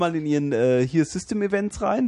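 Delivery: high-pitched scam caller; walk the victim through it in a high put-on voice; phone scammer. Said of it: "die sagen dann".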